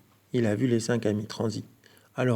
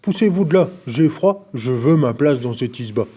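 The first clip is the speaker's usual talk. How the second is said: loud, grumbling bear voice; big bear growling to get in; 7 dB louder than usual. A narrator's reading. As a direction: growl at them to move. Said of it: "Ours balourd grogne".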